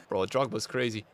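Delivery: ordinary talk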